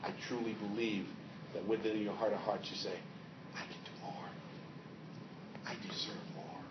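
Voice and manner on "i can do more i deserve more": whispered, lower inner voice. Acted. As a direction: voice the quiet longing inside. Inner voice at the mirror.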